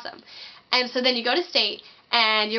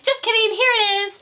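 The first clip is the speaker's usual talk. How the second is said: high, louder singsong tease; much higher playful gotcha voice; teasing friend; 11 semitones higher, 7 dB louder than usual. Retold or imitated. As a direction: chirp it like a smug prankster friend. Friend teasingly reveals gift card.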